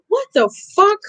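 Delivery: high-pitched exasperated outburst; indignant, high squawk; pissed off. Retold or imitated. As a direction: retell your outraged disbelief, pitch shooting up. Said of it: "I'm really pissed".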